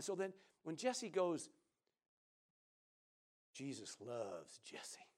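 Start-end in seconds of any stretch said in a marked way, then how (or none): 3.5-5.1 slow, soft whisper; whispered quote. Retold or imitated. Preacher quoting Jesse's phrase in a slow whisper. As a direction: breathe it slowly and reverently.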